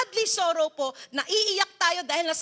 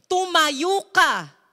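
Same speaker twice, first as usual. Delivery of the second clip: firm command; 2 semitones higher, 7 dB louder, much slower than usual. Acted. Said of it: "ano sabi ni Lord kay Joshua".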